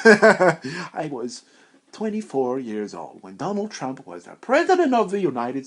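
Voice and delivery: lightly voiced old man breaking into laughs; an old man's ramble through the giggles; old guy, laughing. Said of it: "I'm gonna be like an old guy".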